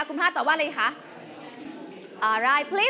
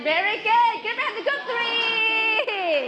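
higher, drawn-out teacher's cheer; excited praise; 6 semitones higher, much slower than usual. Real, not acted. Teacher praises group three's right answer, excited cheer. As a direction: cheer the team on, stretching the last word.

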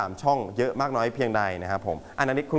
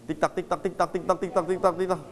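clock ticking; 3 semitones higher, 3 dB quieter, much faster than usual. Retold or imitated. Teacher mimics clock while waiting.

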